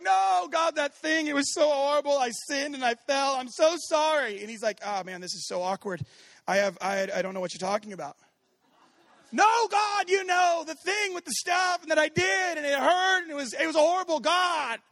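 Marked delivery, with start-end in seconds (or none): 0.0-4.4 higher, louder begging confession; desperate pleading. Acted. Believer begging God for forgiveness, desperate pleading. 4.8-8.2 awkward amused God. Acted. God voiced awkward, laughing. 9.3-14.8 much higher, shouted desperate confession; frantic shouting plea. Acted. Believer shouting confession at God, frantic plea.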